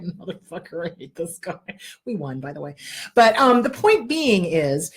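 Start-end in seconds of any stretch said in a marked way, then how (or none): 0.0-1.6 low, much softer grumble under the breath; muttered curse. Retold or imitated. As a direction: mutter it so nobody else hears. Inner grumble in court.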